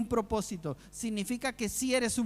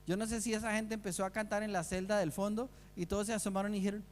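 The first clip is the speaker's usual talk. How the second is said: ordinary talk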